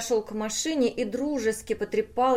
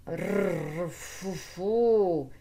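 rolled growl, then blown puffs; machine growl and puffing; much slower than usual. A narrator's reading. The brain breaks down under blows, spluttering. Beaten machine makes noises.

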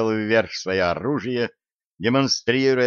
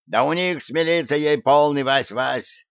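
put-on higher-pitched, spiteful old man; angry old man's complaint, pitched up; spiteful cry; 5 semitones higher than usual. A narrator's reading. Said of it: "злобно крикнул дед с клюкой".

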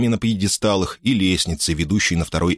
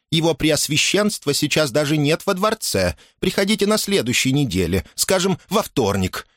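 high-voiced, officious housekeeper; adamant, raised-pitch brush-off; unyielding; 7 semitones higher than usual. A narrator's reading. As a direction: turn the visitor away firmly. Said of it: "непреклонным тоном заявила домоправительница".

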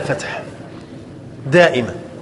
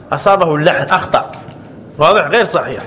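ordinary talk, nothing marked

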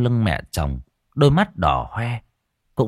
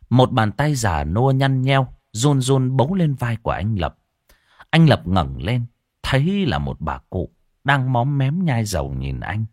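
ordinary delivery

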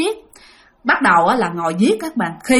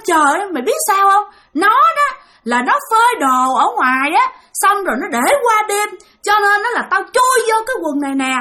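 higher, mocking ghost voice; gloating ghost; 9 semitones higher than usual. Ghost boasts to the father about the trousers, higher gloating taunt. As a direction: taunt him, proud of the trick. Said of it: "Cái chỉ vô cái quần đen nói rằng".

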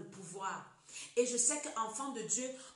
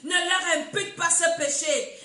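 loud, raised-pitch cry to the Lord; 6 semitones higher, 11 dB louder, slower than usual. Acted. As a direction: cry out a dying man's prayer with full voice. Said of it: "il s'écria d'une voix forte". (cri d'une voix forte)

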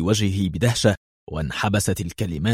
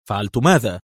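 usual speaking voice

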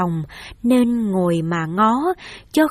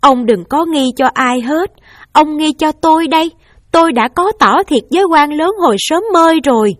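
raised, hurried pleading through tears; wife tearfully confessing to husband, voice raised and rushed; tearful confession; 5 semitones higher, 4 dB louder than usual. Acted. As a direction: confess it in a rush, near sobbing. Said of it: "và khóc và nói".

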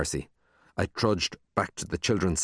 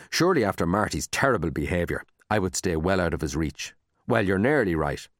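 plain reading voice

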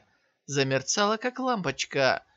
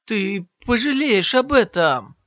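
gritted-teeth threat, louder; through gritted teeth; 5 semitones higher, 7 dB louder than usual. A narrator's reading. Defeated warrior threatens Shiyan, through gritted teeth. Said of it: "сказал сквозь зубы воин".

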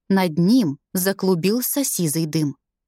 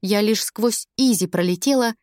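ordinary delivery, nothing marked

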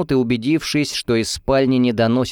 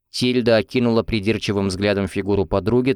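plain reading voice

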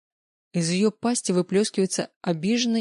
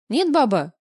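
high squeak of panic; squeaky; 6 semitones higher, 5 dB louder than usual. Acted. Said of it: "пищу я".

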